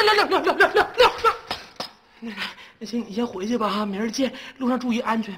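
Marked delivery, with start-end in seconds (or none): 0.0-1.4 high, loud crazed babble; feigned madness. Pretend. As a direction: babble nonsense like a lunatic.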